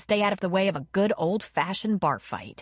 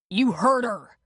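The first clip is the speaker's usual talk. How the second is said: louder taunting yell; 4 semitones higher, 5 dB louder, slower than usual. A narrator's reading. Man yelling at his rival, taunting yell. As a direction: bark it at the rival. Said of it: "Connor yelled at Dylan".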